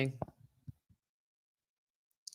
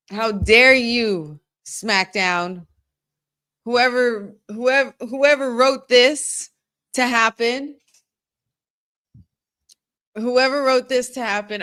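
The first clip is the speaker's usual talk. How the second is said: loud, slow, high rant at the writers; mock outrage; 4 semitones higher, 5 dB louder, slower than usual. Real, not acted. Fan scolding whoever booked the loss, loud mock outrage.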